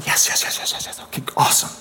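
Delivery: whispered yeses; soft whispered approval. Real, not acted. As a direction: breathe out a hushed yes, yes. Answering the crowd softly.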